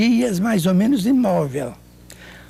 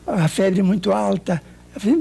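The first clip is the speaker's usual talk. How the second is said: normal talking voice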